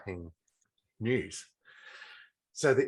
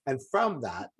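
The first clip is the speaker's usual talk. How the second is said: ordinary talk, nothing marked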